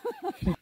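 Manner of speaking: short high-pitched giggle; a sudden high laugh at the joke; high burst of laughter. Real, not acted. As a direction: let out a quick squeaky laugh. Reacting to sulking joke.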